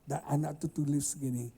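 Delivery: usual speaking voice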